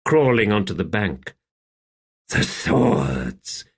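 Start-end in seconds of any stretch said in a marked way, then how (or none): 2.3-3.7 gasped. A narrator's reading. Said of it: "he gasped".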